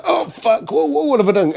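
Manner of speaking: high-pitched mock panic; retold panic. Man summoned outside by his business partner, mock panic. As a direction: stammer it in a comic, high fluster.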